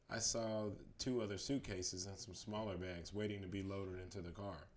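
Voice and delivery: usual speaking voice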